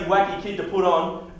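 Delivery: ordinary talk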